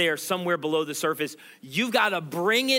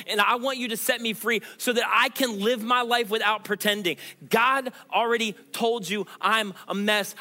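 raised-pitch, urgent sermon appeal; impassioned preaching; 3 semitones higher than usual. Real, not acted. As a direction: preach it as a heartfelt declaration to God.

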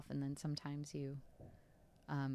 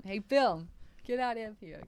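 loud, higher mock dismissal; mock shouted order; 5 semitones higher, 7 dB louder than usual. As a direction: playfully yell him out of the place. Imagined order shouted at Bill.

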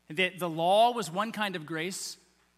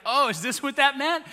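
acted surprise, higher and louder; as if it finally clicks, pitched up; sudden realization; 7 semitones higher, 6 dB louder than usual. Disciples voiced realizing the meaning late.

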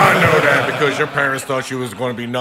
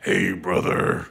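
wrestling promo; 6 semitones lower, 6 dB quieter, much slower than usual. Retold or imitated. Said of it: "I love the wrestling voice".

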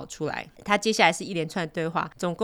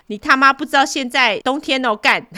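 angry scolding; 7 semitones higher, 8 dB louder than usual. Retold or imitated. Father scolding the thief.

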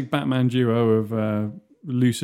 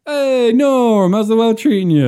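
high, loud hailing call; sitcom bar greeting; 8 semitones higher, 8 dB louder, slower than usual. Acted. Barfly hailing a regular in Cheers, loud high greeting. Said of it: "Cheers in it".

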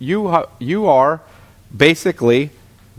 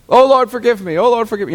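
higher-pitched imitation of wailing repentance; mock crying plea; 7 semitones higher, faster than usual. Someone on their bed crying out to the Lord, mock tearful. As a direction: plead as if weeping, lightly acted. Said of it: "you know, et cetera, crying".

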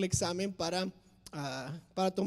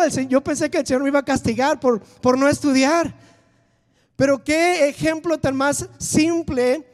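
loud, driving sermon voice; full-force preaching; 5 semitones higher, 11 dB louder than usual. Acted. Preacher urging his church to pray, loud and driving. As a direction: preach it to the back row.

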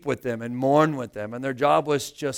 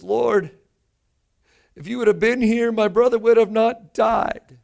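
pained plea; 9 semitones higher, 7 dB louder than usual. Acted. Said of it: "You can almost hear the pain in her voice".

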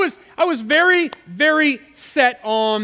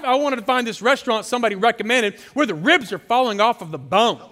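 ordinary talk, nothing marked

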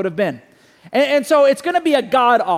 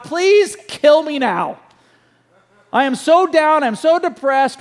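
crying out; 5 semitones higher, 3 dB louder, slower than usual. Retold or imitated. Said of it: "crying out to God".

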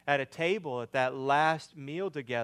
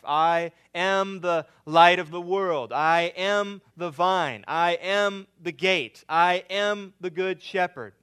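preaching; 3 semitones higher, 8 dB louder than usual. Real, not acted. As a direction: declare each one with force. Preaches the I am statements.

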